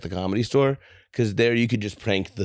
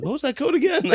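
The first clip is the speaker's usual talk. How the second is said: confused customer; faster than usual. Retold or imitated. Customers asking about fake code.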